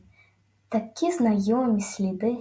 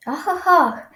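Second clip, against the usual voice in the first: higher, louder astonished ah ah ah; amazed gasping; 6 semitones higher, 6 dB louder, slower than usual. A narrator's reading. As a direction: gasp in amazement at the blow. Amazed at the hit.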